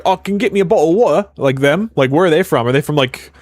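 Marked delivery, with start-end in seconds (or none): 0.0-1.3 quick, high British impression with dropped t's; mock British accent. Streamer showing chat which Brits he means, in a mock British accent. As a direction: rattle it off as a caricature Brit. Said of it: "it's the Brits that are like".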